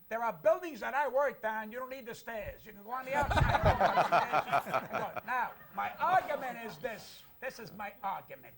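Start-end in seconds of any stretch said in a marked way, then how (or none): 3.5-5.2 big loud laugh bursting out; loud hearty laugh. Real, not acted. Guest cracking up at his own bricklayer joke, loud laugh.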